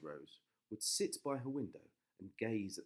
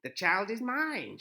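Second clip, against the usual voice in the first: high, loud, slow witch; triumphant old witch; 11 semitones higher, 13 dB louder, slower than usual. A narrator's reading. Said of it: "she cried".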